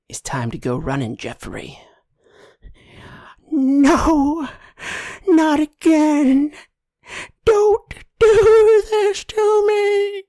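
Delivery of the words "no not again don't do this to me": very high, loud, slow anguished wail; a tortured shoe begging not to run; drawn-out high shrieking plea; pleading talking shoe. Acted.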